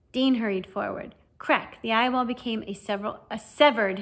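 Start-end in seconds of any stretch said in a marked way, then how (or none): none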